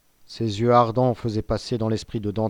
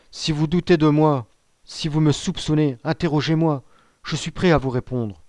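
raised, urgent appeal easing at the end; pleading cry; 5 semitones higher, 4 dB louder than usual. A narrator's reading. Young prisoner begging to be questioned, crying out earnestly. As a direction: plead with voice lifted high. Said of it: "s'écria le malheureux jeune homme".

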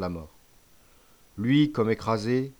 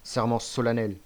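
usual speaking voice

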